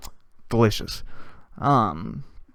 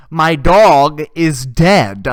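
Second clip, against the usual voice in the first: loud, every word dragged out; mock exasperated outburst; 2 semitones higher, 8 dB louder, much slower than usual. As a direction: hammer it slowly, imitating her exasperation. Retold angry repeat to a friend.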